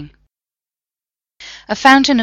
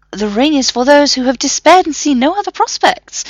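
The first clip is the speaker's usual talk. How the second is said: high, louder explanatory professor voice; fussy professor; 8 semitones higher, 6 dB louder than usual. A narrator's reading. Professor explaining the ring, high and fussy. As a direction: lecture it like a pedantic don.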